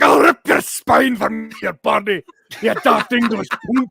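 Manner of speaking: loud, high, slow roar; over-the-top enraged warrior; hammed-up furious threat. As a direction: bellow a mock battle threat. Mock threat at English tourist.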